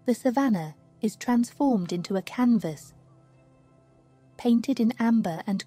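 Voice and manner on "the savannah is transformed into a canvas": usual speaking voice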